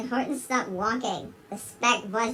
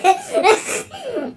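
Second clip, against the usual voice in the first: loud high laughter burst; 11 semitones higher, 7 dB louder than usual. Real, not acted. Reader laughing at the eagle's question.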